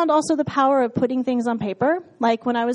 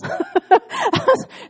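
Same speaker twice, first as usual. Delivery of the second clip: high laugh bursting out; real laughter at herself; 13 semitones higher, 4 dB louder than usual. Real, not acted. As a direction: laugh out loud, high and free. Laughing at her own doubts.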